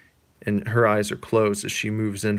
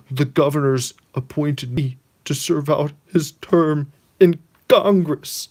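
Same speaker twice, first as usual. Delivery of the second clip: high, loud, slow mock drama; hammed-up somber news; grave announcement; 5 semitones higher, 9 dB louder, slower than usual. Pete telling his wife the news, hammed-up grave. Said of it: "And he says".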